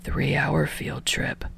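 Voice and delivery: whispered weary sigh, slow. Acted. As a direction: sigh it out tired. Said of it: "Aizawa sighs".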